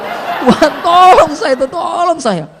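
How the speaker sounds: high, loud cry for help to God; desperate plea. Acted. Preacher begging God to silence the voice, hammed-up desperate cry. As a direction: wail it out in mock desperation.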